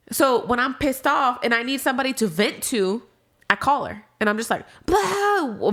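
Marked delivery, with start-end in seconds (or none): 4.8-5.7 high, drawn-out falling "blah"; mock venting "blah". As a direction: spew out a high mock blurt. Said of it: "vomiting at the mouth".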